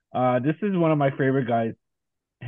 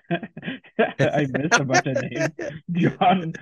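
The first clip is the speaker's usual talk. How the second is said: slow, amused, laughing admission; 4 semitones higher, much slower than usual. Real, not acted. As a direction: laugh it off, drawn out. Laughing at own mistake.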